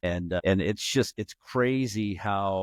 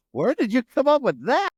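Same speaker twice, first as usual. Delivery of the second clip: much higher, louder, disbelieving; incredulous wife; 6 dB louder than usual. Retold or imitated. Wife scoffing at her husband's doomsday story, incredulous. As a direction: ask it like the idea is absurd.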